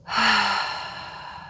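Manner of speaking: long audible sigh of relief. Shown as a demonstration. Guide showing the listener how to exhale, relieved sigh. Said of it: "letting out a sigh of relief".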